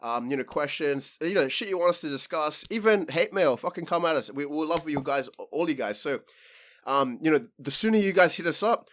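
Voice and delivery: loud, pumped-up promo to listeners; podcast shout-out. Acted. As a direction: hype it up like a radio plug.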